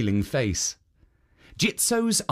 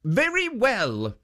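higher, gentle warning voice; a kind guardian warning her; kindly statue's voice; 9 semitones higher, slower than usual. A narrator's reading. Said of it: "said Jitso".

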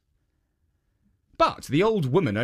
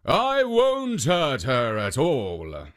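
ogre promising; slower than usual. Acted. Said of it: "promised the Oni".

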